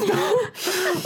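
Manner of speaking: short squealing laugh, very high; real laughing burst; high burst of laughter. Real, not acted. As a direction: break into laughter. Laughs at kid's DM reply.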